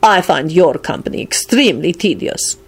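lightly haughty lord; loftily bored by his companion. A narrator's reading. Lord Radu to Barnabas about his tedious company, haughty. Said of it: "Radu replied haughtily".

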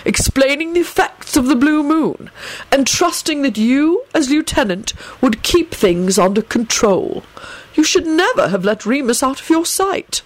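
cool, formal headmaster; cold rebuke. A narrator's reading. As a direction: reprimand a subordinate coldly. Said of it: "Dumbledore responded coldly".

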